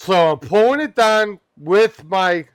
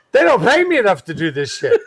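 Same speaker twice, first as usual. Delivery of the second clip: laughing; 11 semitones higher than usual. Real, not acted. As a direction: crack up mid-sentence. Laughing at his own story.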